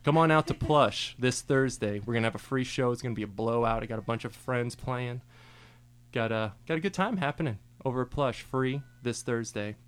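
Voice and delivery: loud, punchy DJ plug for the show; hyped radio DJ plugging a free show; radio promo read. Acted. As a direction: sell the free Thursday gig like an on-air ad. On-air promo hype for show.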